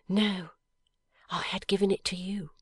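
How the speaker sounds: lightly voiced young wife, tender and earnest; a hint of Lizzie. A narrator's reading. Young wife answering her dying husband, gently earnest.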